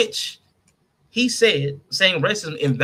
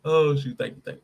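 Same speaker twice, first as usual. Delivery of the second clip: ordinary delivery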